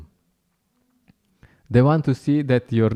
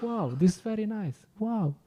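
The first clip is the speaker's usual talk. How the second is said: higher, softer wondering wow; delighted wow; 9 semitones higher, 8 dB quieter than usual. Retold or imitated. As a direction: marvel at it, hushed and delighted. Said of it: "You're like".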